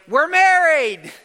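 high, drawn-out celebratory cry; triumphant, loud and stretched; jubilant shout. Acted. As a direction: shout it out like a wedding-feast cheer. Celebrating the newlyweds' marriage feast.

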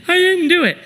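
very high mock-kid whine; high-pitched childish protest. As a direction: imitate a boy squealing his denial. Child threatened with spanking protests.

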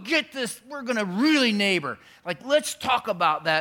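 loud, high, eager voice; eager pastors. Retold or imitated. Pastors pitching the city manager, loud and eager. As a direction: hype it up like excited pastors. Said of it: "and they said".

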